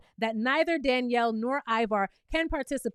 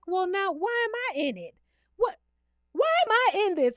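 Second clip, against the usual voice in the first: mock confused Brittany; 6 semitones higher than usual. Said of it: "Brittany is like".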